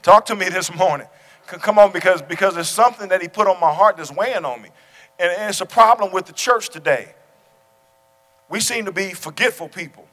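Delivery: fervent sermon climax; preaching at full force. Real, not acted. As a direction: drive each phrase home from the pulpit.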